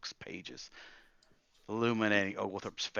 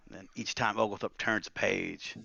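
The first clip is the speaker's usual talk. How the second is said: plain reading voice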